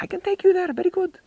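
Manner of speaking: Egyptian broken English. Retold or imitated. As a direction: pitch a street tout's broken Egyptian English way up high. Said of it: "And he's like".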